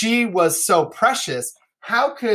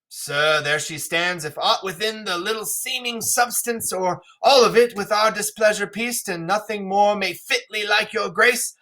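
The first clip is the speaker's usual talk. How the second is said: higher-pitched theatrical king; stagey royal speech, pitched up; King Lear; 6 semitones higher than usual. Acted. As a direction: declaim as a king coldly offering his fallen daughter. Reads Lear's speech aloud.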